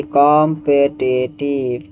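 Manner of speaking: "Competitive" stretched very slowly; drawn-out repetition. Shown as a demonstration. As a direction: spell out "competitive" slowly for the class. Teacher repeats word slowly for students. (धीरे-धीरे खींचकर दोहराते हुए)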